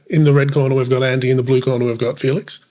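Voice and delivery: ordinary talk, nothing marked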